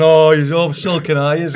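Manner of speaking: slow, high, loud teasing through laughter; mocking laugh. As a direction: tease him while laughing. Mocking the sulking manager.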